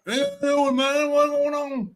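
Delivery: high mumbly [name] impression; [name] picking up the phone. Cohost imagines [name] answering the phone, high mock greeting. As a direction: toss it off like a fried rock star. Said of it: "picking up the phone like".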